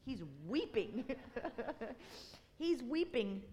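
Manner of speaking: laughing through the words; amused laughing. Real, not acted. Telling the congregation his seatmate outweeps him, laughing. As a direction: chuckle at being outdone. Man outdoing him in weeping.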